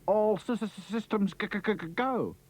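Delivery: stuttering on the first sounds; stammering. Acted. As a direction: trip over the consonants.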